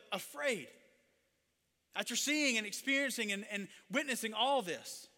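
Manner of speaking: loud, high preacher's delivery; impassioned preaching. Acted. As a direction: drive the point home forcefully. Preaches at raised force.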